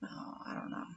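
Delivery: whispered, unsure aside. Real, not acted. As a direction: whisper it with a shrug.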